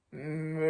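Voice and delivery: long, drawn-out cow moo; pig mooing. Acted. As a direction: imitate a cow with a sustained moo. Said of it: "said the pig".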